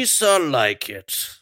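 slow, put-on Gungan voice; lightly voiced Gungan ruler; Boss Nass impression. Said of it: "This is my Boss Nass".

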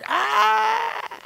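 high-pitched hammed-up shriek; mock frantic scream. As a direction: scream like an overexcited exorcist. Mocks frantic casting out of devils.

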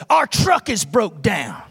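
slow, drawn-out bully dare; imitated bully, very slow delivery; mocking challenge. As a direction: drag it out as a taunt. Imitates bully daring preacher.

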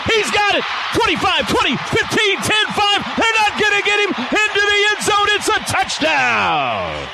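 high, shouted yard countdown; excited countdown call. Real, not acted. Long touchdown run called live.

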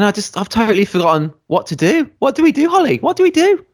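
playful high-pitched helpless plea; mock panic. Mock panic at forgetting his job.

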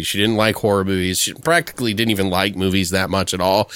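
usual speaking voice

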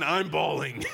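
real laughter over the words; laughing. Real, not acted. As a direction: laugh it out at your own tears. Laughing about own tears.

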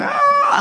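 very high mock scream; piercing imitated animal wail. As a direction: shriek like a screaming dog. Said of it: "like that screaming".

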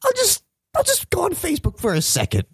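very high, squeaky quoted thought; tempted past self. Retold or imitated. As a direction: voice your own weak excuse in falsetto. Acting out own late-night temptation.

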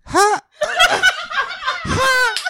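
high squeak breaking into giggles; laughing. Retold or imitated. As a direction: try the voice and crack up. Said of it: "my Dawn Lysol accent".

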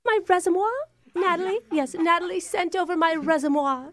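hammed-up British applicant; fake British accent. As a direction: put on a British accent, politely insistent. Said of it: "I'm a little surprised by your accent".

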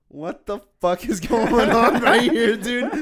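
high, loud, drawn-out outburst; retold exasperated disbelief. Storyteller reliving a neighborhood confrontation, loudly incredulous. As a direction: blurt it out loud and incredulous, half laughing.

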